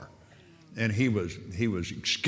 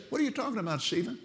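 much higher voice for her baffled question; puzzled woman; 13 semitones higher, 3 dB quieter, much faster than usual. Retold or imitated. Captive woman to her kidnapper who hears voices, puzzled. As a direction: act out her bewilderment, pitched well up.

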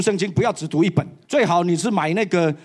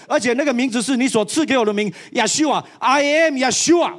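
emphatic proclamation; 4 semitones higher than usual. Real, not acted. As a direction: proclaim the name with full force. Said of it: "proclaiming the divine name". (大声宣告)

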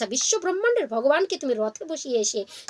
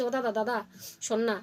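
soft coaxing plea; 2 semitones lower, 8 dB quieter than usual. Acted. Hungry jackal coaxing his brother.

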